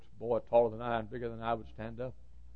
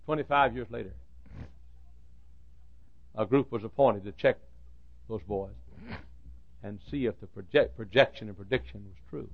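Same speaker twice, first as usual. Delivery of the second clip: usual speaking voice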